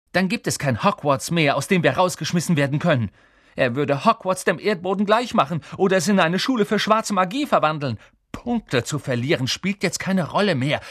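high-pitched, urgent argument; exasperated, heated pleading; impassioned outburst. A narrator's reading. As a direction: make your friends grasp the danger. Impassioned argument continuing from a shout.

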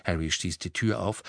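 ordinary delivery, nothing marked

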